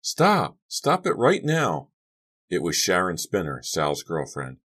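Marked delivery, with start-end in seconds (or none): 0.0-1.9 put-on high, drawn-out girl's yell; urgent yell. A narrator's reading. Girl running toward the fight, high urgent yell. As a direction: order them to stop at once.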